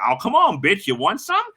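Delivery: high-pitched mock challenge; taunting. Rival with dukes up in the parking lot, taunting. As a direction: imitate a man itching to fight. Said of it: "got his dukes up".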